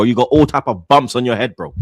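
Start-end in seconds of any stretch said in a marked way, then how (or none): none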